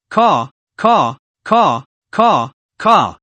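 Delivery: mild cawing battle cry, lightly voiced; kobold war cry. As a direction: caw like a goblin leading a charge. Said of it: "The tendon kobold bellowed".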